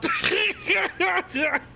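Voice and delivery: high cackling fit; real squealing laugh; helpless laughter. Real, not acted. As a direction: burst out laughing uncontrollably. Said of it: "laughing at that so much".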